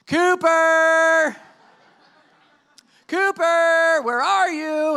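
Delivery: high, loud, drawn-out call; searching shout. Retold or imitated. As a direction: holler for a lost dog across a lot. Calling for lost dog.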